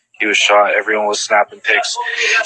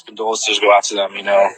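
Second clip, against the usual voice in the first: ordinary talk, nothing marked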